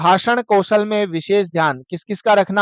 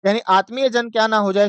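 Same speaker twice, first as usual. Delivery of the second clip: usual speaking voice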